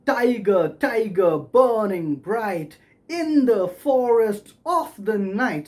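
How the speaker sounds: slow chant-like beats, with "in" and "of" stressed; measured recitation leaning hard on "in" and "of"; stressed verse beats. Shown as a demonstration. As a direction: punch "in" and "of" on the beat. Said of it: "of are stressed because the rhyme demands it".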